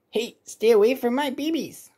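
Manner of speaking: high squawky warning; cartoon bird voice. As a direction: shoo them off like a bird. Mother bird warns intruder.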